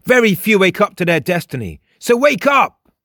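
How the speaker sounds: high and emphatic, building to a shout; loud high character voice rising to a cry; urgent exhortation. A narrator's reading. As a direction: urge them on, then shout. Frost exhorts Jake, ending in shout.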